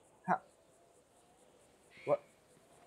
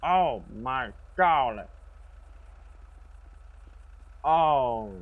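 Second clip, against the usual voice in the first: loud, drawn-out, slightly higher cry of dismay; alarmed exclamation; 3 semitones higher, 6 dB louder, much slower than usual. Pretend. Old game character reacting to trouble, loud drawn-out alarm. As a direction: stretch each exclamation out loudly.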